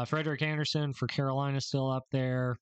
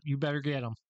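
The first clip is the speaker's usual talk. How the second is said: normal talking voice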